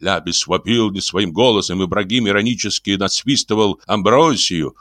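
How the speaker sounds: ordinary delivery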